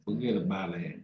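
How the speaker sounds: low, soft Brooklyn brush-off; Brooklyn accent. Pretend. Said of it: "do your best Brooklyn accent".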